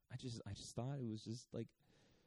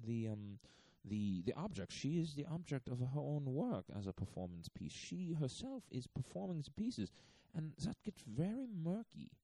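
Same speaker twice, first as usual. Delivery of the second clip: channel the odd curator, half mocking; a light imitation of the curator's European accent; imitating Ulay's accent; 3 semitones higher than usual. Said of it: "he basically goes".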